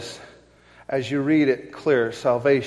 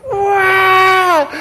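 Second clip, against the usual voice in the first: loud high cry of wonder; crowd cry; 10 dB louder than usual. Retold or imitated. Crowd at the basketball game, crying out loud and high. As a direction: howl it like a crowd. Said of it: "everybody goes".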